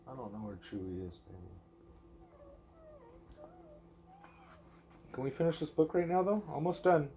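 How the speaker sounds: lilting bedtime-story read-aloud; reading to a child. Acted. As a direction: read it gently to a little one at bedtime. Reading a picture book aloud.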